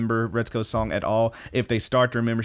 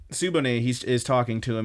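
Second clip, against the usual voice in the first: ordinary talk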